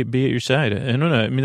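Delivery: ordinary talk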